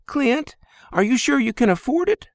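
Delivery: put-on Lizzie, girl's voice. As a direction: ask it straight, a bit wary.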